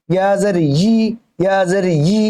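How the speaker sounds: "ই" as yi, with a drawn y before the vowel; y-glide onset. Shown as a demonstration. Teacher shows correct ya, long yi. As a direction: press a y into the last "ই". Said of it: "ই হলে কিন্তু হামজা হয়ে যাবে".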